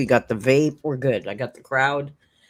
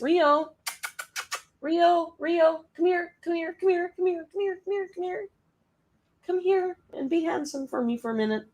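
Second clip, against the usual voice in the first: coaxing the cat; 14 semitones higher than usual. Real, not acted. Streamer coaxing her cat onto camera, high cooing call.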